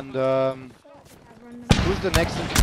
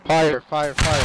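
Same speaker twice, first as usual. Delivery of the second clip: shouting orders; 7 dB louder, much slower than usual. Real, not acted. Ordering volley, loud.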